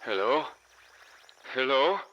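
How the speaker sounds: high, rising urgent calls; frantic calling out. A narrator's reading. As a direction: call anxiously for anyone listening. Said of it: "I heard a frantic voice calling out".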